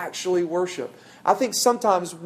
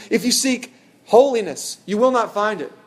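louder and higher, driving the point home; emphatic preaching; 6 semitones higher, 6 dB louder than usual. Acted. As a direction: hit holiness hard, like a pulpit climax. Climax of quoted Carson passage.

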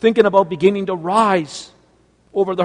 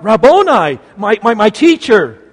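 loud joyful cry, much louder; 4 semitones higher, 10 dB louder than usual. Acted. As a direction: burst out with overwhelming joy. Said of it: "she cries out".

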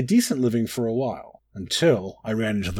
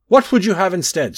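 loud, raised call at a departing customer; shout after someone; 7 semitones higher, 7 dB louder than usual. A narrator's reading. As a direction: call out higher and louder to stop them leaving. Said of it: "I shouted over his shoulder".

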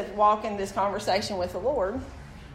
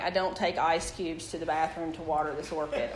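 ordinary delivery

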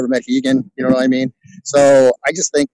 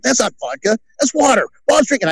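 teasing partygoer; 9 semitones higher than usual. Retold or imitated. Partygoers catch Bob drinking water.

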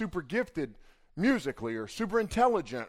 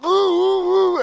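high, loud imitated groaning; mock guttural moan; 13 semitones higher, 10 dB louder, slower than usual. Nicole on the floor after the fall, a high, loud imitated groan. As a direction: copy her cry after the fall. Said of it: "making this real guttural noise".

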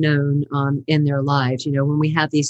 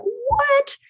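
a squeaky, much higher astonished "what"; incredulous what, pitched way up; shocked what. Retold or imitated. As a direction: react to the price in disbelief. Shocked at sixty-five dollar price.

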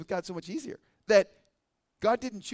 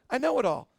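higher, mock know-it-all boast; 6 semitones higher, 4 dB louder than usual. Churchgoer dismissing more Bible study, mock know-it-all. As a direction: play a churchgoer who thinks they're done learning.

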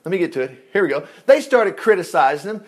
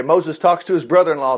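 usual speaking voice

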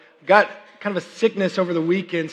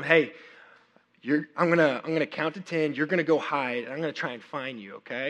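soft, low patient explanation; quietly walk a little kid through the rules; dad softly teaching his son a game; gentle explaining; 4 semitones lower, 7 dB quieter than usual. Retold or imitated.

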